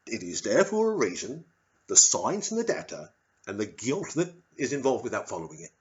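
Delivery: grovelling, repeated sir in a put-on British voice; overly polite officer. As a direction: smother them with politeness. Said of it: "kill you with politeness".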